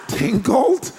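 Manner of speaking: high, loud rising question; mock-astonished query; incredulous echo. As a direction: repeat the silly word in disbelief. Echoes silly word in disbelief.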